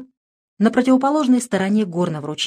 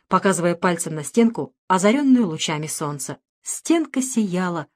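ordinary delivery, nothing marked

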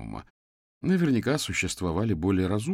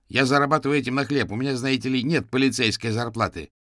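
loud, higher, indignant justification; irritated retort; 5 semitones higher, 5 dB louder than usual. A narrator's reading. Юрген defends his living to the policeman, irritated. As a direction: argue back with heat. Юрген argues back after barking.

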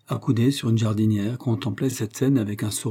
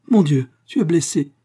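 high, louder cry of shock; alarmed exclamation; 6 semitones higher, 7 dB louder than usual. A narrator's reading. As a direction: exclaim in dismay at an injury. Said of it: "s'exclama-t-elle en pâlissant".

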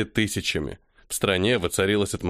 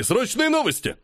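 even higher, frantic second call; more urgent call; 13 semitones higher than usual. Acted. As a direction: push harder on the repeat.

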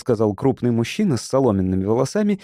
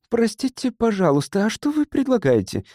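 high-pitched female character voice; woman's voice; 10 semitones higher than usual. A narrator's reading. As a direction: ask politely in a much higher woman's voice. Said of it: "спросила темноволосая женщина".

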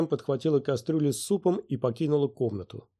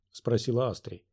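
plain reading voice

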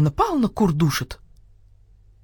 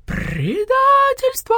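very high, long-drawn cry; outraged crowing scream; 4 dB louder, much slower than usual. A narrator's reading. Rooster unmasks the starling, high crowing scream. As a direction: crow the alarm, stretching the word. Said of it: "завопил Петюня".

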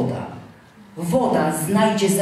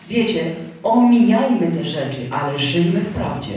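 normal talking voice

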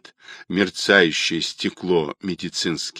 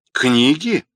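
higher, rising astonished question; surprised; 5 semitones higher, 3 dB louder than usual. A narrator's reading. Caller can't believe Rodionov offers books, surprised gasp. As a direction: echo it as if misheard. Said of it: "удивлённо ахнул голос, будто ослышавшись".

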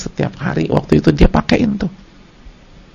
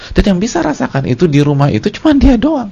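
raised, louder preaching voice; full-force preaching; 12 semitones higher, 6 dB louder than usual. Acted. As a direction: drive the point home to the congregation. (ceramah berapi-api)

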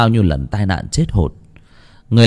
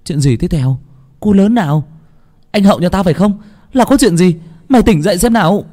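high, urgent astonished questioning; 10 semitones higher, 4 dB louder than usual. A narrator's reading. Child questions the delirious friend, astonished. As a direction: fire off startled questions, pitched well up. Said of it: "thì cô bé kinh ngạc".

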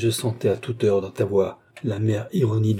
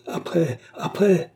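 high, eager repeated prompt; breathless urgency; 8 semitones higher, slower than usual. A narrator's reading. Bastien presses Felippone to continue, breathlessly urgent.

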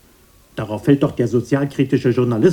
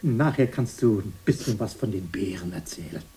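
whisper; 3 semitones lower, 7 dB quieter than usual. Acted. Coaxing aside.

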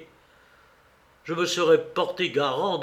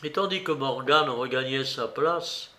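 usual speaking voice

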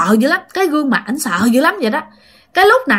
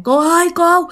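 higher, panicked cry for help; frightened young man crying for help; terrified calling out; 8 semitones higher, faster than usual. Retold or imitated. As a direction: plead in terror, much higher and rushed. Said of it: "ảnh vừa la làng".

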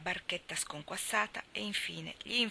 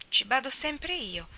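1 self-important; 6 semitones higher, 4 dB louder, slower than usual. Acted. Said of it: "con aria d'importanza".

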